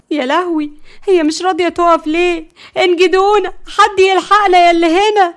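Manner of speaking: high, loud cries for help, building; frightened panic. A narrator's reading. Layla has lost control of the car, panicked shouting. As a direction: scream out for rescue. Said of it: "فقالت بخوف".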